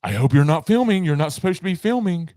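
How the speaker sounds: high, loud imitated scolding; retold cast member. Cast member to riders on a broken-down ride, imitated scolding. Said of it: "one of the cast members goes".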